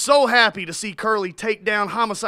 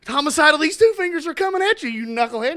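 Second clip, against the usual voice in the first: Curly threat; 7 semitones higher, 5 dB louder than usual. Acted.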